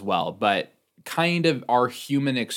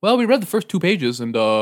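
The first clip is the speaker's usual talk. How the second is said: high put-on parent voice; 9 semitones higher, 4 dB louder than usual. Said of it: "they're like".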